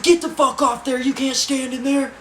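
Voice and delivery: loud, high-pitched scolding yell; imitating a furious guard; guard's angry shout. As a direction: holler the order across a cell block. Guard yells at climbing boy.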